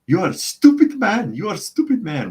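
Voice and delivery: shrill-high, loud mock European accent; weird European accent. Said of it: "with, like, this weird European accent".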